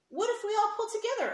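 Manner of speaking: high, fast and loud suggestion; eager leprechaun. Acted. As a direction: pitch it up like a bright idea. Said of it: "another leprechaun said".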